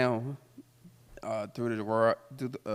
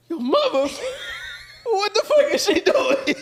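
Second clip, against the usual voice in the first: high, loud disbelieving shout while laughing; shocked yell; 7 dB louder than usual. Retold or imitated. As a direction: yell it like you can't believe his mother is coming. Friend shocked mother is coming.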